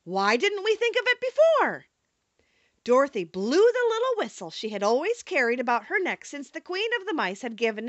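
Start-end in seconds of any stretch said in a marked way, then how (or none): 0.0-1.9 high, eager storybook exclamation; crying out. A narrator's reading. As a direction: cry out at a sudden good idea, mildly. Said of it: "cried the Scarecrow".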